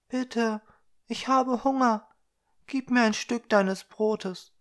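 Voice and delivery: put-on a small hungry girl asking for bread; begging little girl. Little girl begging Blaugrün for bread, high and pleading.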